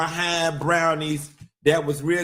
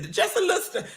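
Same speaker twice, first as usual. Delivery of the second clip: mocking high voice; 12 semitones higher, 4 dB quieter, much faster than usual. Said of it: "the people say".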